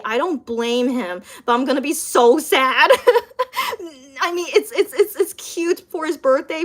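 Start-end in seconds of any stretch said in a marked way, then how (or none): 3.0-3.9 teary laugh. Real, not acted. Sad about birthday release date.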